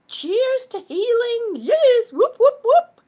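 loud, high whooping; excited cheer. Real, not acted. Streamer toasts healing with viewers, excited cheering.

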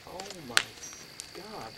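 hushed, breathless oh my God; barely voiced, stunned; hushed shock. Seeing a figure in the room.

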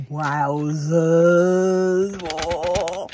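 loud delighted wowzers; gushing wow. Real, not acted. Swooning over her tied-up figure, loud and delighted.

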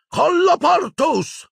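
very high, drawn-out incantation cry; shouted spell. A narrator's reading. Harry seals the door against attackers, shouting. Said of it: "gridò".

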